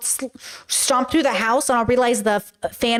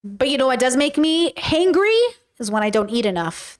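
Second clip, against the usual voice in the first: ordinary talk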